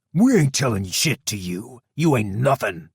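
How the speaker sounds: loud defiant shout at Kenny. A narrator's reading. Thug shouting defiance at his captor, loud. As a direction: spit it out, loud and contemptuous. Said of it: "Brute gave Kenny a vile look and shouted".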